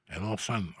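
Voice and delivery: ordinary delivery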